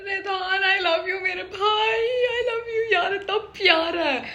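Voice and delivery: high, soft, breaking into a cry; choked up, gushing love through tears; teary with love. Real, not acted. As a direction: voice cracking with affection. Moved to tears over screen brothers.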